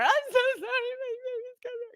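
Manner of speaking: high, pleading sorry to the little ones; tearful apology. Retold or imitated. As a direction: beg forgiveness through tears. Hugging the crying girls, apologizing.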